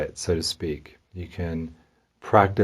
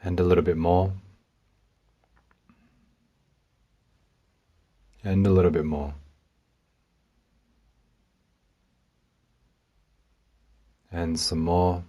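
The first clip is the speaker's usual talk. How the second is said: guided meditation; 4 dB quieter than usual. Real, not acted. Meditation guide coaxes listener to release a little more, softly. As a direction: ease them into letting go, bit by bit.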